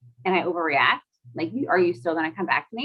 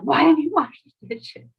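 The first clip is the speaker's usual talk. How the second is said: raised, higher exasperated scolding; higher, louder mock scolding of the kids; mock snap at kids; 7 semitones higher, 4 dB louder than usual. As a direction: play the frazzled mom snapping at her kids. Mother snapping at kids over dishes.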